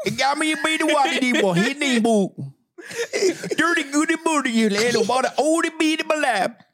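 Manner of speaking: rising and falling gibberish recitation; gibberish Bible reading. Pretend. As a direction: recite scripture as rubbery nonsense syllables. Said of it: "Is this song twenty-three?".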